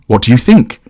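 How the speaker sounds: expectant. A narrator's reading. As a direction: ask it eagerly, awaiting approval. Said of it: "she asks Michael expectantly".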